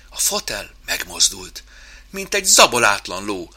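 ordinary delivery, nothing marked

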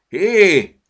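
a loud high hey; shout. A narrator's reading. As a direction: shout at the squirrel to stop. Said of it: "kiáltott rá Petya".

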